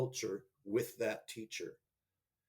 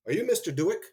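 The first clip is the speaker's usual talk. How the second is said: imitating a stern tone; 7 semitones higher, 8 dB louder, faster than usual. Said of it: "I didn't like the tone of his voice".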